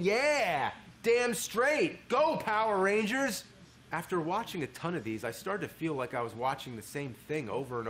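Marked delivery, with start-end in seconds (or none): 0.0-3.4 high, loud fan cheer; roused, emphatic yell of approval; fired-up cheering. Acted. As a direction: cheer them on at full pump. Voices a pumped-up fan's reaction.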